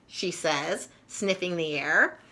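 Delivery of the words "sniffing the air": plain reading voice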